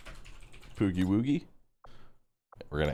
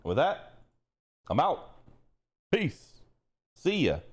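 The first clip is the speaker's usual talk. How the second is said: chirpy high sign-off; 6 semitones higher, slower than usual. Real, not acted.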